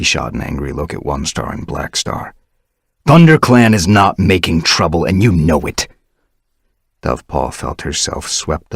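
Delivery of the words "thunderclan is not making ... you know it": louder firm rebuke; firm denial. Acted. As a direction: stand firm against them. Firestar angry.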